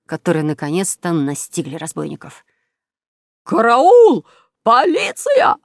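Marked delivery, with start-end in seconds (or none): 3.4-5.6 high, loud cry of alarm; shrieked alarm, pitched way up; panicked shout. Acted. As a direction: yell for help as a caught crook. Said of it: "крикнул усатый Марио".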